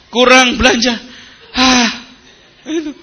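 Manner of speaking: high-pitched grumble, then an exasperated huff; mock complaining. Preacher mimicking a wife short of shopping money, mock complaining. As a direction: moan it and puff out a sigh. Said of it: "diselesaikan dengan ngeluh".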